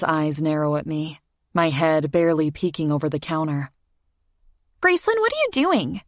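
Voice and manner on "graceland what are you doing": put-on high, quick child's voice; young girl voice. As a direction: play a curious little girl. Child voice in story.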